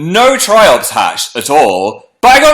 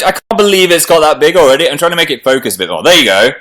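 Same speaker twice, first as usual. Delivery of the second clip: ordinary talk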